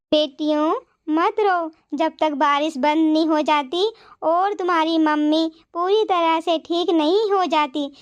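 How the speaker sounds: put-on a caring granny soothing two wet girls; kind old woman. Old woman inviting the crying girls home, gentle and low.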